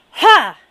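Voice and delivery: explosive high loud ha; release shout ha. Shown as a demonstration. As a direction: fire the ha out to the universe. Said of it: "when I release, I go".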